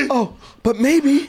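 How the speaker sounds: hopeful mom voice. Retold or imitated. Voicing hopeful mother.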